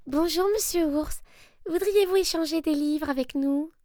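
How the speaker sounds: high, bright-eyed little rabbit asking politely; a small eager rabbit at the door; high polite bunny voice. Acted. Rabbit invites bear.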